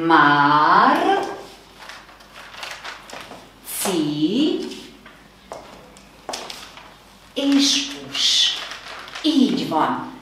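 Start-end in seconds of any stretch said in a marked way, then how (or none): 0.1-8.5 "Már" "ci" "és" "us" spread into separate beats; syllabified. Shown as a demonstration. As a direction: break "Március" into slow syllables. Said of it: "Gyertek, szótagoljuk".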